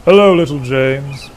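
loud, puffed-out greeting; puffing. A narrator's reading. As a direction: puff out a hearty hello. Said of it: "puffed Gordon".

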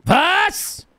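high, loud exclamation of disbelief; shocked outburst. Acted. Reacting to a colleague's news, shocked high yell. Startled outburst at what was said.

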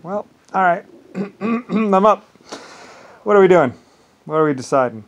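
hammed-up eager call to action; roused, loud. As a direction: boom out, ready for duty. Called out of retirement, quoted.

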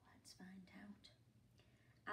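slow hushed whisper. Acted. Reader building suspense for children, slow whisper. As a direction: draw them in slowly, whispering.

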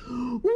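long, high howled cry; storybook fox howl, held long; howling wail. A narrator's reading. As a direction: throw out a drawn-out wail. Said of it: "threw his head back and howled".

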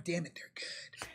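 hushed, grudging whisper. Acted. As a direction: breathe it under your breath. Grudging praise, then repeated aloud.

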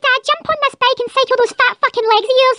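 ordinary talk

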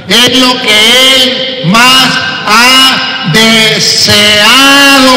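normal talking voice

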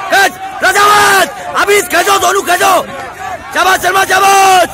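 coach's shouted cheering. Real, not acted. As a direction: keep yelling praise to drive the team on. Coach shouting encouragement over training. (ज़ोर से हौसला बढ़ाती coach की पुकार)

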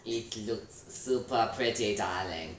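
slow, drawn-out fancy voice; posh darling voice. Pretend. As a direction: gush like a snooty socialite. Fancy compliment to co-player.

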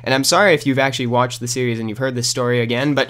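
ordinary talk

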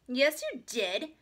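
high, loud, drawn-out contradiction; insist, stretching each word; insistent. A narrator's reading. Little sister contradicts reader, insistent. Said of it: "insisted Ramona".

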